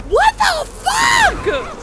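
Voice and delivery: very slow, high, loud outburst; shocked shout. Real, not acted. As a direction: yell it out in disbelief. Shocked by vanishing bees.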